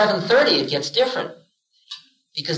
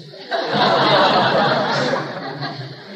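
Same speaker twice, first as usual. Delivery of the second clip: real laugh; 4 semitones higher than usual. Real, not acted. Teacher laughing at the karma council joke, real chuckle.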